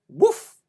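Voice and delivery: sharp loud bark, high; dog barking out, loud woof. Acted. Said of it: "said the dog".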